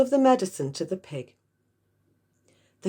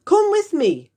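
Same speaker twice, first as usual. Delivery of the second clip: high, loud yell; 12 semitones higher, 8 dB louder than usual. Acted. Mister Cranky yelling at George to come, high and loud. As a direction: bark the order with urgency. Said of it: "Mister Cranky yelled".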